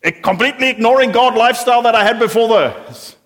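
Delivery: mock the self-satisfied fair-weather believer; preacher parodying someone glad to drop God, smug and loud; smug relieved believer. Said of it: "and it's like".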